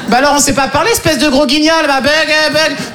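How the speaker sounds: high, teasing family taunt; mocking jeer. Relatives teasing the stutterer, high jeer. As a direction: needle the victim in a high voice.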